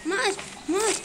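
high, loud repeated shouts; frenzied battle cries. Real, not acted. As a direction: shout with every hit. Frenzied attack on dragon. (जोश में ऊँची आवाज़ से ललकार)

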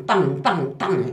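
spoken gunfire sound effects; gunfire noises. Retold or imitated. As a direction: fire off a quick burst of mock shots. Imitating gunfire.

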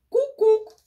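high, loud eerie cuckoo cry; loud high bird call; scary cuckoo call. A narrator's reading. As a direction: make the dream bird shriek its call. Scary dream bird calls.

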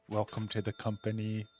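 soft, hushed welcome whisper; sweet nothings. Pretend. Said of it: "I'm gonna go whisper sweet nothings".

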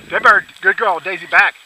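loud, high call across the field; shouting to dog. Real, not acted. Hunter calling to his dog far off, shouting. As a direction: yell the command out to the dog.

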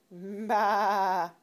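long wavering sheep baa; playful imitation of a sheep; sheep bleat. Sheep noise over picture book.

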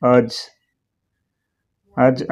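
"అర్జ్" modelled with a silent R. Shown as a demonstration. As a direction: say "అర్జ్" as one syllable, R unsounded. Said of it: "అర్జ్ అని పలకాలి".

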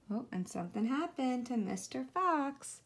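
plain reading voice

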